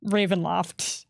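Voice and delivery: ordinary talk, nothing marked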